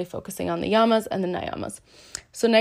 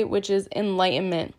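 ordinary talk, nothing marked

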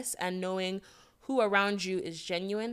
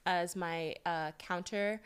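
put-on pretty girl voice; 2 semitones lower, 4 dB quieter than usual. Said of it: "putting on your pretty girl voice".

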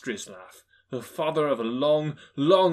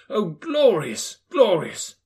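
slow, louder, rising joy; exultant narration; 7 semitones higher, 8 dB louder, slower than usual. A narrator's reading. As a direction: savour the morning.

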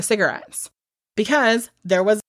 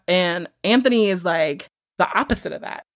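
ordinary talk

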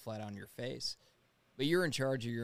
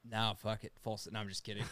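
normal talking voice

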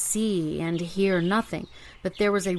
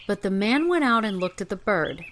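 usual speaking voice

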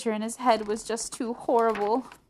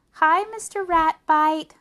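bratty little sister; 10 semitones higher, 10 dB louder, slower than usual. A narrator's reading. D W teases the teacher.

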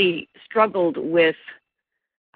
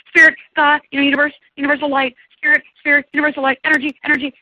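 much higher, fast word list; high, hurried mock chant of safe words; frantic reframing; 10 semitones higher, 4 dB louder, faster than usual. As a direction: rattle off substitutes for God in a panic. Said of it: "I worked overtime in that session going".

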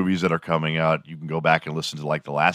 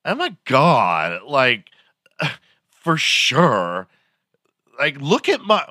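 high, drawn-out mock Valley girl; 7 semitones higher, 4 dB louder, much slower than usual. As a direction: put on a Valley girl, stretching words up high. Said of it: "talking like a Valley girl".

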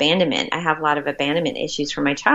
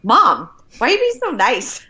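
little daughter; 7 semitones higher, 4 dB louder than usual. Retold or imitated. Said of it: "My little one looks at me".

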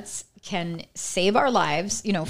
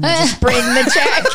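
laughing; 9 semitones higher, 5 dB louder than usual. Retold or imitated. Bribing waitress, cracking up.

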